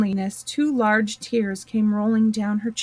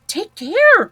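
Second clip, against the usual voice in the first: high, louder warning call; alarmed warning to the Queen; cried out; 11 semitones higher, 8 dB louder than usual. Acted. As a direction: call out to stop her. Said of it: "cried Alice".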